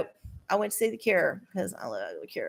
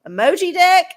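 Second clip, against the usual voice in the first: mock wrestling callout; 7 semitones higher, 9 dB louder than usual. Calling out the heel deck.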